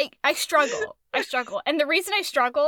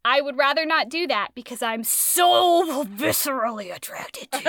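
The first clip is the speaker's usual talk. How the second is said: mock lovestruck. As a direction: lean hard on "so", playfully overdramatic. Voicing the smitten Leander in retelling.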